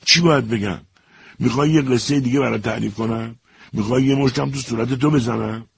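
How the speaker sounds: angry retort. Acted. Soldier jumping up to threaten the major, angry. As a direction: throw it back in his face. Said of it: "با خشم گفت".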